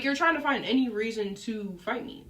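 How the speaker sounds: ordinary talk, nothing marked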